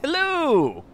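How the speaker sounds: a high, loud call of hello across the street; shouted greeting. Acted. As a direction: call out hello to get its attention. Calling out to creature again.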